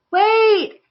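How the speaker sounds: high, loud cry after friends swimming away; cried out. Acted. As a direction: shout to make them stop. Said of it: "cried Rocky".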